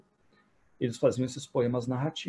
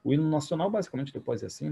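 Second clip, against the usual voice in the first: normal talking voice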